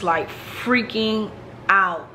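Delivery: "freaking" stretched out slowly, a pause, then "out"; drawn-out emphasis. Real, not acted. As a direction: draw out "freaking", set "out" apart. Stressing how panicked she was.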